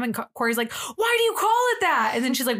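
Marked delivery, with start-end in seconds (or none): 0.7-2.1 high-pitched retold outburst of disbelief; mock exasperation. Friend challenges the museum's name, mock exasperation.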